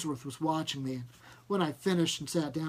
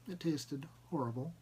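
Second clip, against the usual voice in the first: plain reading voice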